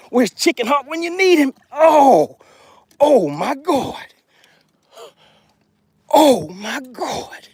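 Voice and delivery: high, strained exclamations; high-pitched, stunned and thrilled; overwhelmed excitement. Real, not acted. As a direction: cry out in amazement, stretching the words. Lands the big fish.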